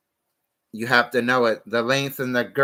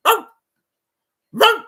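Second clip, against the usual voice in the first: yappy chihuahua voice; 9 dB louder than usual. Retold or imitated. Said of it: "You sound like a chihuahua".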